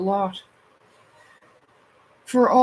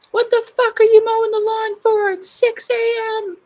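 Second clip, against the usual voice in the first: high, loud raging shout at the neighbours; outraged, much higher and louder; furious yell; 13 semitones higher, 5 dB louder than usual. Retold or imitated. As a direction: scream it at the lawn mowers. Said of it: "I used to get so mad".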